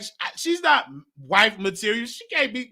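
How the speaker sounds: usual speaking voice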